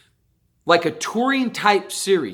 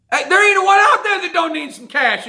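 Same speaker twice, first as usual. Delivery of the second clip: much higher and louder, fed up; loud, exasperated scolding; exasperated outburst; 13 semitones higher, 6 dB louder than usual. Real, not acted. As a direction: throw it out in disbelief. Exasperated rant at drivers.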